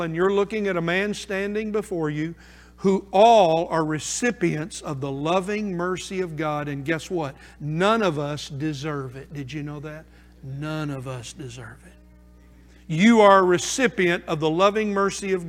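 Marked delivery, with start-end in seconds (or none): 3.7-11.9 low, soft sermon aside; subdued, lowered preacher's voice; hushed preaching. Real, not acted. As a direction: drop right down and confide it to the congregation. Preacher drops to quiet, confiding exhortation.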